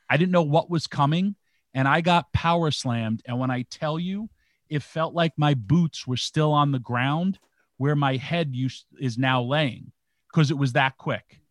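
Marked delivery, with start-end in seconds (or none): none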